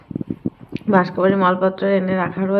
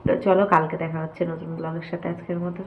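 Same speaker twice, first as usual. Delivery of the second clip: softer and softer, too tired to go on; exhausted, fading out; 6 dB quieter than usual. Real, not acted. Signing off the vlog to her viewers, worn out and fading. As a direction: let the voice sink away as the strength runs out. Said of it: "আর পারছি না continue করতে".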